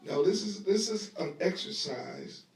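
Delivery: ordinary talk, nothing marked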